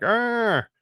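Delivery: a sudden high mock roar; mock lady roar. Describing a creepy painting's face, a playful high roar. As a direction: voice the open-mouthed lady with a quick high growl. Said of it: "it's like a lady going like".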